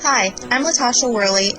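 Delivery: ordinary talk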